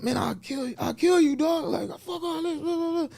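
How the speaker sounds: high-pitched imitation of a worked-up man; acted threat. Gunman expressing himself at the host, high mock threat.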